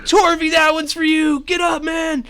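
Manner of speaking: high, loud battle cry; triumphant shout. Acted. NPC shouting to downed friend after his arrow lands. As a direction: yell it like a dedication.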